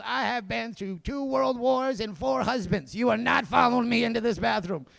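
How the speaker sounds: hammed-up scolding, each word weighed; acted indignant refusal. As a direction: lay down the law as an indignant old lady. Indignant resident refusing company, acted out.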